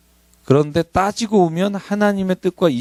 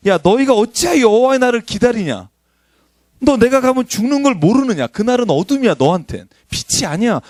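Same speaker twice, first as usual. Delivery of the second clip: much higher, louder exasperated scolding; a fed-up God telling them off; 10 semitones higher, 4 dB louder than usual. Retold or imitated. Preacher voicing God scolding his people, high exasperated. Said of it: "이 표현이 그 말이에요".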